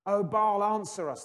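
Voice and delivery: high, loud cry to Baal; shouted plea. Acted. Prophets of Baal crying out, a loud high plea. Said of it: "they shouted".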